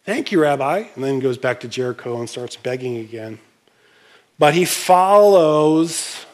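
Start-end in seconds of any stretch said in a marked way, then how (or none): 0.0-0.8 casual thanks. Retold or imitated. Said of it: "he doesn't just go".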